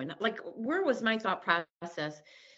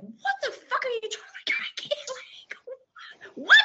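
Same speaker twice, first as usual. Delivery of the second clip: much higher outburst, collapsing to a whisper; furious snap. Retold or imitated. A wife turning on her husband in counseling, furious and much higher. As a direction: whip round on him, outraged. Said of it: "my head flips around like Linda Blair".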